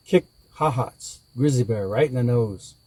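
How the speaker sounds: plain reading voice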